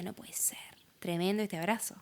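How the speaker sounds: soft, near-whispered inner voice; barely audible astonished aside; hushed disbelief. Retold or imitated. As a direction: murmur it to yourself in disbelief. Recalling disbelief at a hug.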